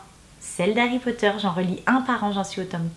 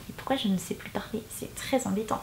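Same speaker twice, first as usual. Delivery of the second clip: whisper; 9 dB quieter than usual. Real, not acted. Tongue-tied, complains in aside.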